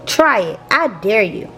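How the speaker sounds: plain reading voice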